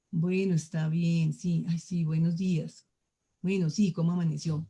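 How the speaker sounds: low, subdued, reluctant greeting; grumpy, out of sorts. Shown as a demonstration. Speaker demonstrating a good morning from someone indisposed, grumpy. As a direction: greet as if in a bad mood. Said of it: "Uy, está indispuesto".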